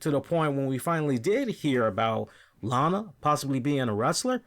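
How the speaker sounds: ordinary delivery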